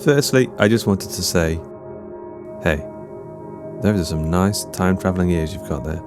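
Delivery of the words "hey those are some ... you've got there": ordinary delivery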